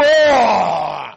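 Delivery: loud, low, drawn-out roar; intimidating roar. Acted. Someone trying to frighten you, intimidating. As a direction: bellow it to scare them. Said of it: "trying to assume something intimidating".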